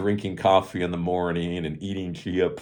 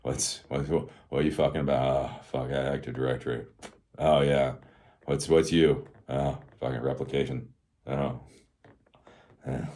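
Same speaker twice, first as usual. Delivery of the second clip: distracted techies; 5 semitones lower than usual. Coworkers colliding in the office, acted dazed and distracted. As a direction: trade half-finished remarks, minds elsewhere, long gaps. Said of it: "when we used to collide in the office".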